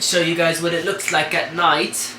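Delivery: ordinary delivery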